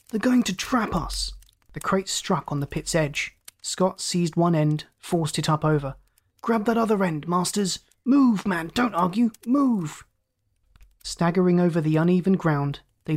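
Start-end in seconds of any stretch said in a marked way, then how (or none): 0.1-1.3 high, breath-heavy warning; urgent, raised-pitch exclamation; alarmed cry. A narrator's reading. Alarmed cry to his men. 6.4-10.1 high, forceful commands; exclaimed, raised-pitch urging; urgent orders. A narrator's reading. Urgent orders to his men.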